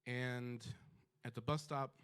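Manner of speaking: ordinary talk, nothing marked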